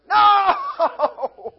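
laughing off the hypocrite. Real, not acted. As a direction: burst out laughing no. Rejecting the bad example.

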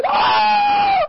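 hammed-up scream of the damned; mock scream. Preacher imitating the damned screaming in the fire, piercing. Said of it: "they're screaming".

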